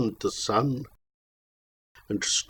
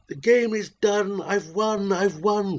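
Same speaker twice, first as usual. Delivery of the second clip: triumphant spectre woman; 7 semitones higher than usual. Acted. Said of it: "quoth she".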